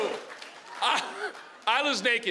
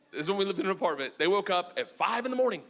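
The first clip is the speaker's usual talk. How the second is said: ordinary talk, nothing marked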